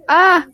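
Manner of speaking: sharp high loud yelp of shock; shocked scream. Acted. Kid discovering the missing toddler, screaming. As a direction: cry out in alarm.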